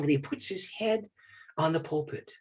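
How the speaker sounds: usual speaking voice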